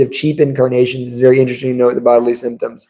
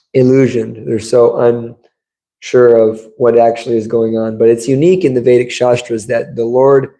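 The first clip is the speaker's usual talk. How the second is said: ordinary talk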